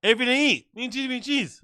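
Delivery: high sing-song imitation of a comic; high mocking copy of someone's inflection; mimicked comic's inflection. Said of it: "he's got that same voice inflection".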